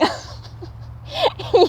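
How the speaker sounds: high airy giggle; soft breathy laugh. A narrator's reading. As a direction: giggle helplessly. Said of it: "couldn't stop laughing".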